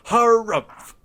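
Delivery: hammed-up, high and loud harrumph; old radio announcer. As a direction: boom out a 1920s announcer's harrumph. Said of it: "I'm also a nineteen twenties radio announcer".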